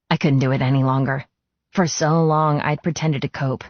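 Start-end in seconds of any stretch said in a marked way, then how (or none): none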